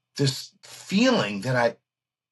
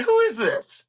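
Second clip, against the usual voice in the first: astonished; 12 semitones higher than usual. Retold or imitated. Guitarist demanding who is really calling, astonished. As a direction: voice leaps up in shock. Suspects a prank call.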